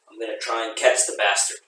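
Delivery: ordinary delivery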